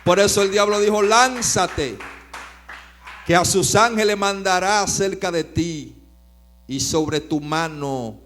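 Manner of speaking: preacher proclaiming to the congregation; full force preaching. Acted. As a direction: preach it with fire.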